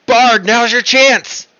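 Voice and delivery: high, loud, drawn-out battle shout; yelled call. Acted. Player's character cueing the bard to act, yelling out. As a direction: holler it slowly across the battlefield. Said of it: "I yell out".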